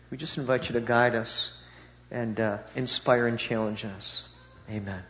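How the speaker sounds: soft, reverent prayer voice. Acted. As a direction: pray it quietly to God. Opening prayer.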